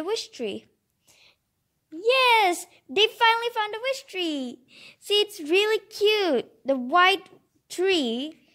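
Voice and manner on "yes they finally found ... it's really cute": ordinary delivery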